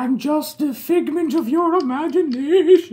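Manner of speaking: high, slow, drawn-out phantom voice; slow wailing hallucination voice; eerie figment. Acted. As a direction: stretch it out like a haunting apparition. Stalactite speaks as a figment.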